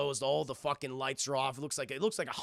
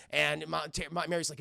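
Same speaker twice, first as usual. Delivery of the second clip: ordinary talk, nothing marked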